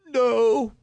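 high, loud acted "no"; emphatic retold refusal. Girl refuses to surrender her life, loud and emphatic.

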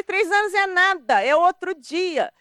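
high, loud, drawn-out protest; outraged "it was just yesterday"; indignant insistence. Retold or imitated. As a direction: push back hard at the family. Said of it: "Eu falei".

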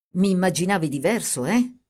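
plain reading voice

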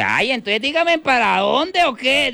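high-pitched mock exasperation; exasperated taxi driver. Taxi driver asking passengers the way, high exasperated voice. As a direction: demand directions, voice jumping up high.

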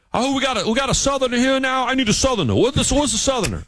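high-pitched, urgent mock demand; hammed-up high voice of an exasperated colleague; frantic coworker. New England coworker hunting for a Southerner, frantic.

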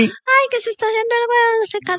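mimicking his voice. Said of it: "él te dijo".

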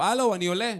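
mock calling up. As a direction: imitate hailing a neighbour from below, not really shouting. Retold calling up to a neighbor. (קריאה מבוימת לשכן למעלה)

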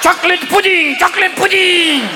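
put-on high, sing-out call of a confused old pope; John Paul the Second. Senile pope in Yankee Stadium, high chanting proclamation.

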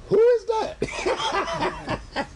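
mock amazement at himself; surprised disbelief. Shy man hearing he preached, amazed and laughing. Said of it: "And I'm like".

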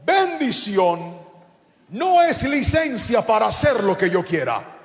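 high, emphatic proclamation with stretched words; full-force preaching. Real, not acted. Preacher voicing God setting the land's borders, full-force preaching.

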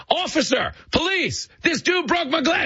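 high mock yell for the police; fake yelling. Hustler calling the police on tourists, high hammed-up yell. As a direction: yell for a cop like a con man hamming it up. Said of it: "the guy just starts yelling".

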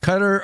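Qatar as "CUT-er"; "Qatar" with a weak second syllable; stress on first syllable. Shown as a demonstration. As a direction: say Qatar like the word cutter. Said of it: "the proper is Qatar".

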